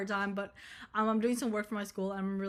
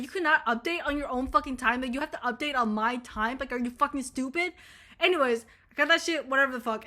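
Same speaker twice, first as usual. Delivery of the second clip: ordinary talk, nothing marked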